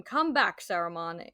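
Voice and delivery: sharp commanding call, not a casual "come back". Retold or imitated. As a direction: call him back like a wizard who will be obeyed. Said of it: "Gandalf said in a commanding voice".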